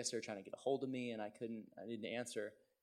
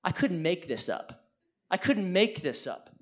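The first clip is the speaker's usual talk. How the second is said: loud, high, falling exclamation; amazed exclamation; 7 semitones higher, 13 dB louder than usual. Real, not acted. Preacher marvels at the irony before congregation, amazed exclamation. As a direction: shout it in disbelief, pitched well up.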